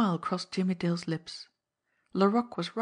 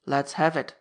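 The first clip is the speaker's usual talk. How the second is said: grunted; 4 semitones lower, 4 dB louder than usual. Acted. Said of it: "grunted Gentleman Laroque".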